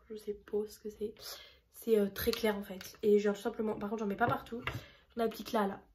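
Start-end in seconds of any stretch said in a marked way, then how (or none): 0.1-1.2 whispered aside. Real, not acted. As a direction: murmure à voix chuchotée. Aside about shade name. (chuchoté en aparté)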